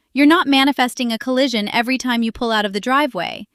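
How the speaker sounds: ordinary talk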